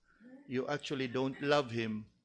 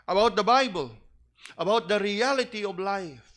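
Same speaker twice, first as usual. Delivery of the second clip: louder and higher, building to a peak; rising preaching; 7 semitones higher, 5 dB louder than usual. Acted. Preacher building up to the fathers' main duty, rising. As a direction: build each phrase toward the climax.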